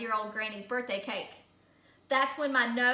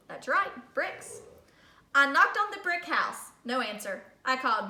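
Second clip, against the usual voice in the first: usual speaking voice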